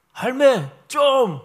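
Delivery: loud, high, drawn-out Gyeongsang-style call; Gyeongsang dialect. Retold or imitated. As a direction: imitate a terse Gyeongsang speaker, slow and forceful. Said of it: "경상도 말의 표현이라고 그래요".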